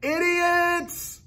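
very high, full-volume yell; piercing long-distance yell; yelling insults. A narrator's reading. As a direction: bellow the insult across the sky. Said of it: "as loud as he could".

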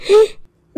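sharp gasp of fright; startled gasp. Acted. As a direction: gasp at the smoke. Smoke rising from book.

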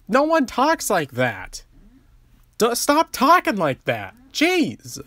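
high, exasperated retort at the screen; exasperated rant. Retold or imitated. Critic scolding the elephants' text-speak, high and exasperated. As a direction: scold the characters like they can hear you. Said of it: "I'm like".

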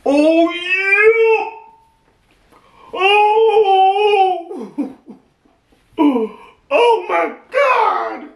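high, drawn-out wailing cry; high-pitched, stretched-out outburst; overwhelmed wail. Real, not acted. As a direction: wail it long and high, overcome. Overcome by the gift.

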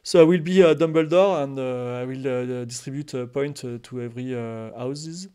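put-on Dumbledore in halting French-accented English; English with a French accent, loud start then dropping away. Streamer trying out his Dumbledore line for the role-play, French-accented English. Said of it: "Dumbledore avec un accent français".

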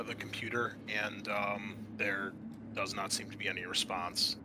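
usual speaking voice

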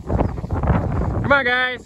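high, carrying call to the flock; calling sheep. Real, not acted. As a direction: sing out to the sheep across the field.

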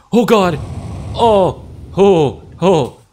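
loud high startled cries; jump-scare yells; startled yelling. Real, not acted. Startled by something in game.

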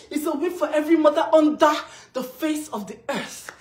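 loud, fast victory cry; triumphant shout. Real, not acted. Mother claiming the win for every mother, triumphant shout. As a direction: proclaim it at full volume, rushing upward.

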